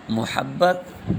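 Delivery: محبت as "mu-HAB-bat", u in the first syllable; pronunciation option. Shown as a demonstration. Teacher reading quiz choices, drawn-out "muhabbat" for محبت. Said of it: "درست تلفظ آپ نے دینا ہے".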